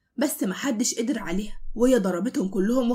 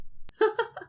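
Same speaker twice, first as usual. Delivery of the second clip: short high unvoiced giggle; 11 semitones higher, 4 dB quieter than usual. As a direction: giggle teasingly at him. Heroine giggles. (ضحكة رفيعة مستفزة)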